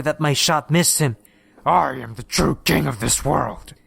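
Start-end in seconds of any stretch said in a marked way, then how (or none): 1.6-3.7 a hint of a hiss, creature voice; hissing monster. A narrator's reading. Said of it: "he hissed".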